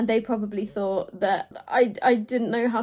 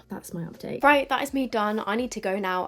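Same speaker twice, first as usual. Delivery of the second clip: ordinary talk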